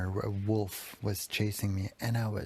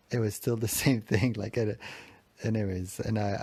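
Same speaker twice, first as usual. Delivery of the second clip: ordinary talk, nothing marked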